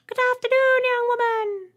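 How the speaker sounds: very high, loud piercing voice; Trembler. A narrator's reading. Alien greeting the human, piercing high voice. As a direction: squeak it out politely. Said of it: "The Trembler on Ethel's right spoke".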